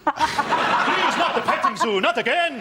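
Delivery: high, laughing plea for mercy; hammed-up desperate pleading; mock pleading badger. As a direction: beg like a tortured cartoon badger. Badger begging under torture.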